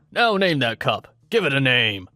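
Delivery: loud barked command; drill sergeant order. Retold or imitated. Drill sergeant ordering trainee to name the cup, loud bark. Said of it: "S D S then tells him".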